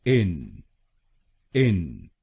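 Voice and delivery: इन said alone as a short clear syllable; syllable demo. Shown as a demonstration. Teacher models the first syllable: isolated syllable. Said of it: "हम बोलेंगे".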